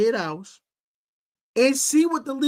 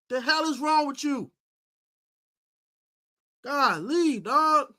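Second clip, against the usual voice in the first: usual speaking voice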